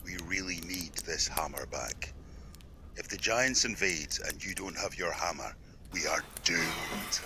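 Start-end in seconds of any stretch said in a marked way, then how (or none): 3.2-7.2 ominous whisper. Acted. Ominous warning.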